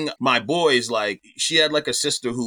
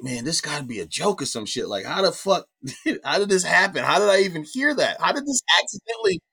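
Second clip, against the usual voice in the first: retold bewilderment, climbing in pitch; stunned disbelief, retold; 5 semitones higher than usual. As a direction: replay a dazed, rising incredulity. Voices his panicked inner reaction.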